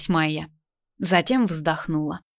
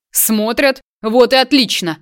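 raised, defiant menace; ominous; 5 semitones higher, 6 dB louder than usual. A narrator's reading. Husband defies his wife's warning, ominously. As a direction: throw the word back, then loudly gloat. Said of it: "произнёс Дубовский зловещим голосом".

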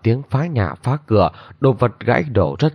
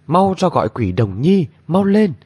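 urgent shout; 7 semitones higher than usual. A narrator's reading. Urgent order to summon helper.

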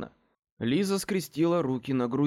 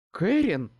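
higher, sharp exclamation of the name; indignant; 5 semitones higher, 4 dB louder than usual. A narrator's reading. Mother protests her daughter's insult, indignant. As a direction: scold with the name alone. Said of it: "возмутилась ... и голос её даже дрогнул".

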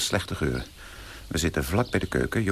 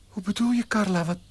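much higher, incredulous; baffled; 13 semitones higher than usual. Acted. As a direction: squeak out bewilderment. Fiancé baffled. (verbijsterd, hoog)

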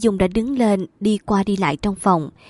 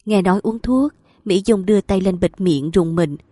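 ordinary delivery

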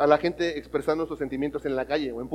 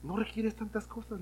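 even quieter, slow; soft repeat; 4 semitones higher, 11 dB quieter than usual. Acted. Preacher softly repeating the lesson to youth. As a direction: let it sink in softly.

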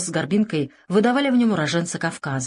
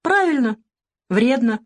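higher-pitched, reasonable agreement; judicious approval; 5 semitones higher than usual. A narrator's reading. Boss answering a non-smoker, judicious. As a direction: approve it sensibly. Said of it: "рассудительно отозвался король".

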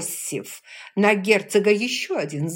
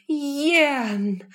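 higher, emotional cry; excited moan; 9 semitones higher, 4 dB louder than usual. Acted. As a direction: call his name in relief. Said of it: "её взволнованным стоном". (взволнованный стон)

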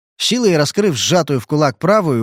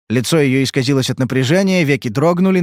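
plain reading voice

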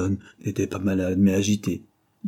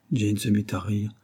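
usual speaking voice

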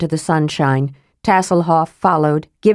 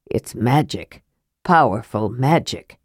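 much lower, softer, hoarse awe; 7 semitones lower, 4 dB quieter than usual. Acted. Awed dwarf to kender, lower soft hoarse. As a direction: hush it out hoarsely. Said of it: "adding hoarsely".

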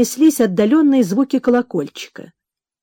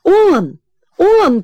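high, loud shout; excited shout; 8 semitones higher, 4 dB louder than usual. Acted. Anton Ivanovich spotting the carriage, shouting high and loud. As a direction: shout it as he jumps up. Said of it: "кричал Антон Иваныч".

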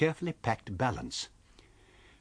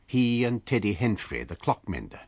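plain reading voice

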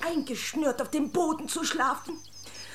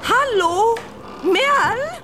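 loud, high call; 9 semitones higher, 8 dB louder, much slower than usual. Acted. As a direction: call across the room for her. Calling for the lost girl. (lautes Rufen, höher)

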